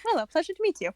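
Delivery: a hint of Catalina, sweet and polite; Catalina greeting. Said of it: "pretend she has a Russian accent".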